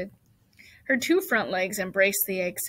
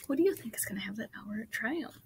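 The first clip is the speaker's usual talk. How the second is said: ordinary delivery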